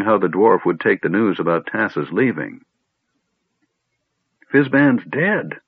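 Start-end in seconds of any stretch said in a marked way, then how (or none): 4.5-5.6 gasped shock. Acted. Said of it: "Flint gasped".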